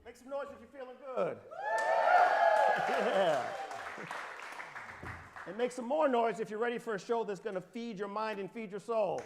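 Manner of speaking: big crowd-warming call; booming motivational speech. Acted. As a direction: hype up the crowd. Hypes up the crowd.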